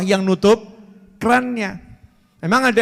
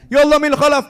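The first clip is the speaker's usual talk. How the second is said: higher and louder cry to God; shouting out; 7 semitones higher, 5 dB louder than usual. Retold or imitated. Preacher acting the cleric crying out loud. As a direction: call out like the whole village can hear. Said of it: "Sampai teriak".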